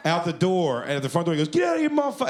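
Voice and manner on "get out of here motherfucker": high-pitched mock yell; furious send-off. Host kicks performer out front door, furious yell.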